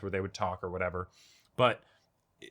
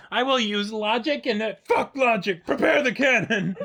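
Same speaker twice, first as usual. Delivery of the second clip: mock character voice; 11 semitones higher, 6 dB louder than usual. Mocking the character's logic.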